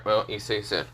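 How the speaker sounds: ordinary delivery, nothing marked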